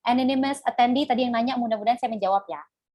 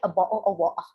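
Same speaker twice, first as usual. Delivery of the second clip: quick demo of a British accent; 6 semitones lower, faster than usual. Shown as a demonstration. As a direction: fake British accent, R left silent in WATER. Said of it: "kalau British like". (aksen British dibuat-buat)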